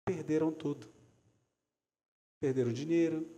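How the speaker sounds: ordinary delivery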